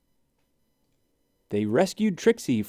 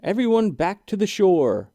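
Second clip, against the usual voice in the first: higher, louder warning call to the skaters; 8 semitones higher, 5 dB louder than usual. A narrator's reading. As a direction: call everyone off the ice. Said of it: "Dominic called out".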